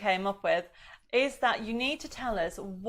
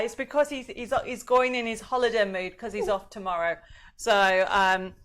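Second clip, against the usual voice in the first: usual speaking voice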